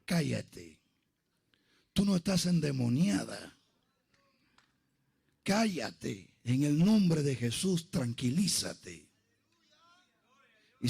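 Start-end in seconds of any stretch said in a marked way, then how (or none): 0.0-9.1 low, measured pastoral order; firm hushing, pitched well down; calming command. Retold or imitated. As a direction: settle a hysterical girl, slowing down at the end. Pastor calming a screaming girl.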